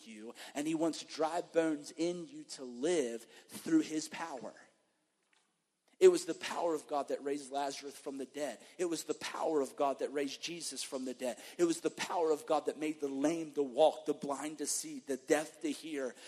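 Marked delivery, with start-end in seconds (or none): 6.0-16.2 loud rising preacher proclamation; preaching at full force. Acted. Preacher declaring God's power to the church, loud preaching. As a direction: build it like a revival preacher.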